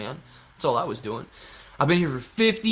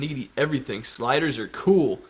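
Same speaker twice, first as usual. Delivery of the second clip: ordinary talk, nothing marked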